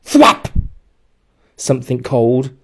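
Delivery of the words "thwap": high loud slapping splash noise; splat sound. A narrator's reading. Boy hit in the face by water, loud splat. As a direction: smack the word like a wet hit.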